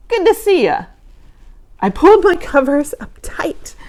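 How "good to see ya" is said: growling. Acted. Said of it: "Gabe growled".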